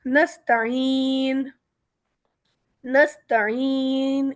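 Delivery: نستعین slow, the ee drawn out; long madd at stop. Shown as a demonstration. Teacher recites نستعین both written ways. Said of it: "دونوں طریقے سے".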